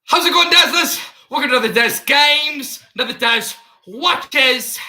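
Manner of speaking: high, loud British youtuber intro; Daz Games impersonation. Pretend. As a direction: greet the Dazzlers like a hyped British youtuber. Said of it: "I'm doing the Daz Games impersonation".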